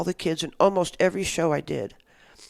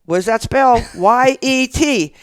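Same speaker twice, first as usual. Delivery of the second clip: loud, raised chant-leader voice; retold rallying call; 6 semitones higher, 8 dB louder, slower than usual. Host spells word for the kids, retold rallying shout. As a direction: call it out, spelling slowly for the crowd.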